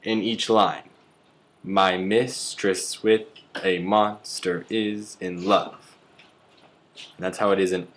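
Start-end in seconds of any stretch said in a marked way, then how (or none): 1.6-5.9 slow, chanted beat on "My mistress with a monster is in love"; iambic stress. Shown as a demonstration. Teaching ten syllables a line, "My mistress with a monster is in love" slowly beaten out in iambs. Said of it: "stress unstressed sounds like".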